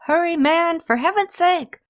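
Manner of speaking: high-pitched impatient holler. Acted. Messenger hollering at the returning sentry, high impatient yell. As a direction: yell it up high through the door. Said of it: "The messenger hollered out".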